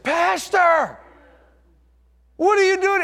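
put-on the boy stunned to see the pastor at his door; surprised boy's shout.

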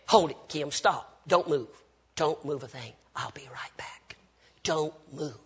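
low, soft, careful freeze command; hushed urgent order. Retold or imitated. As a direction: imitate a pastor quietly holding someone still, fading towards a whisper. Pastor freezes Kim, alarmed.

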